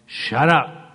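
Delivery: loud, sharp rebuke; forceful command. Acted. Commands his thoughts to be silent.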